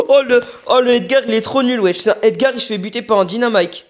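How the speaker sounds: high, loud trash talk; excited mocking. Real, not acted. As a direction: gloat loudly over a weak enemy. Mocking the bad enemy Edgar. (il se moque d'un Edgar nul, fort et aigu)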